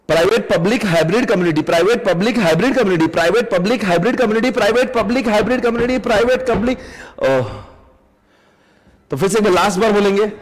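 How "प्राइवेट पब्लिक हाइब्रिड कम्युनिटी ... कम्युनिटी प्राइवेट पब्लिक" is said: fast repeated chant of the four types; rapid drill. Shown as a demonstration. Teacher drilling students fast before exam. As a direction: rattle it off quickly, over and over. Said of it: "फटाफट जल्दी से".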